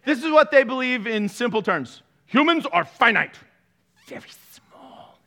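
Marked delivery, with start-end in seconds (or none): 4.1-5.2 breathy whisper of very small. Shown as a demonstration. Pastor stressing how small humans are, whispered. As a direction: drop to a whisper to shrink them. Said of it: "Emphasis on small".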